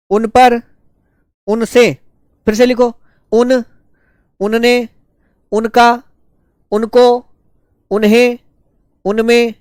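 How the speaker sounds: slow, spaced dictation with a pause after each word. Acted. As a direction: call out each word clearly so the students can write it. Dictates word forms to students.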